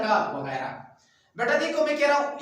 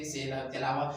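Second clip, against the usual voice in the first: ordinary delivery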